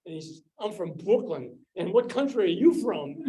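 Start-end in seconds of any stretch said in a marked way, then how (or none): 0.6-1.6 loud imitated Brooklyn accent. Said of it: "in a very heavy Brooklyn accent". 1.7-3.2 loud, higher, hammed-up Brooklyn accent. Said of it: "in a very heavy Brooklyn accent".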